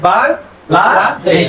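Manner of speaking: Bangladesh split into its syllables, very slow. Shown as a demonstration. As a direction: break Bangladesh apart slowly so each syllable is counted. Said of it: "তার মানে কি?". (বাংলাদেশ শব্দটা অক্ষর ধরে ধরে খুব ধীরে)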